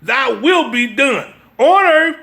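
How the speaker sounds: ordinary talk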